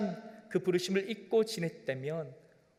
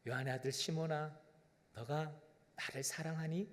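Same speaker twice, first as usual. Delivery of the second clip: much softer, gentle asking; tender question; 3 semitones lower, 10 dB quieter than usual. Pretend. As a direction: ask him softly, like a loving father. (부드럽고 다정하게)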